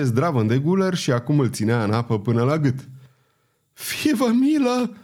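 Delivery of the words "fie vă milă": high, slow, drawn-out cry for mercy; terrified plea. A narrator's reading. Ferryman held underwater, begging Pardaion for mercy in terror. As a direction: beg for your life, voice climbing. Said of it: "strigă podarul alb la față de spaimă".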